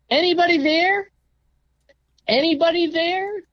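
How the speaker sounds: high, loud call down the empty halls; yelling. Retold or imitated. Patient yelling down the hospital halls for help. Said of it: "So I yell".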